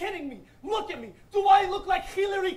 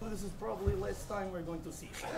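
quieter, quicker rerun of the mock Russian accent, laughing; repeating the accent joke to the crowd at a softer, quicker pace; Russian accent again; 8 semitones lower, 8 dB quieter, faster than usual. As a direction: toss the Russian dad line off fast and soft through a laugh. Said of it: "That accent was good, eh?".

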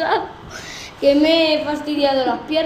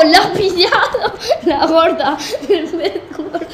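laughing; 3 semitones higher than usual. Real, not acted. Laughing at the crash.

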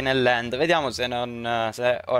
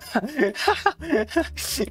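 panicked laugh; 10 semitones higher than usual. Real, not acted. Laughs during chase.

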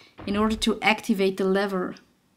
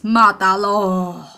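slow drawn-out Matalo; mock villager cry; 3 semitones lower, 3 dB louder, much slower than usual. Gamer playfully copying the villagers' battle cry.

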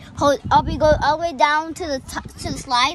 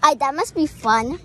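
usual speaking voice

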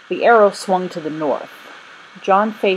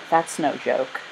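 soft, lower, understated sigh; 4 semitones lower, 6 dB quieter, slower than usual. A narrator's reading. Thomas answering a friend's teasing about the woods, sighing. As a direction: let it drop like a resigned sigh. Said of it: "Thomas sighed".

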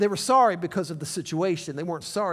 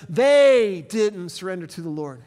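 ordinary talk

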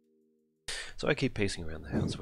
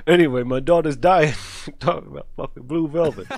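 loud outburst; 7 semitones higher, 12 dB louder than usual. Real, not acted. Frantic laughing outburst about daughter.

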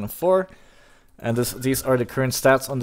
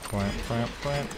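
soft little imitated quacks; mock duck quacks; 10 dB quieter, slower than usual. Streamer goofing to teammates, quietly quacking like a duck.

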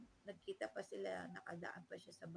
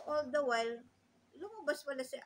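ordinary talk, nothing marked

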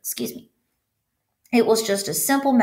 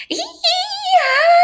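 very high, loud, sustained scream; 7 dB louder than usual. A narrator's reading. Girl whose other pigtail is yanked, screaming. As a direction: let out a long piercing shriek. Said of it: "screamed Leslie".

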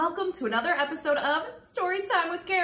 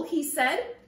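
ordinary delivery, nothing marked

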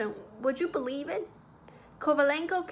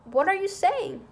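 loud, high astonished question; aghast and raised in pitch; amazed; 6 semitones higher, 6 dB louder than usual. A narrator's reading. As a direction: exclaim in shocked disbelief. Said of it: "amazed at my calm".

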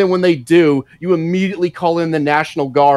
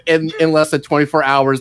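normal talking voice

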